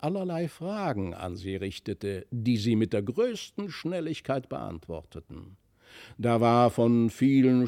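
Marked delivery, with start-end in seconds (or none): none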